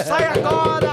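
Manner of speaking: very high imitated angry order; mock furious command in a high put-on voice; Clodovil shouting. Clodovil ordering the intruder out of his bathroom, mock shouting. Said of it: "do Clodovil gritando".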